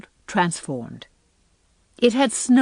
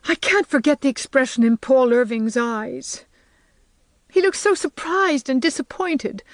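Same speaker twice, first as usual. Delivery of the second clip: a hint of a young girl confessing, higher; girlish voice, ashamed and upset; lightly voiced distressed girl; 5 semitones higher than usual.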